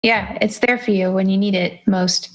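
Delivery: normal talking voice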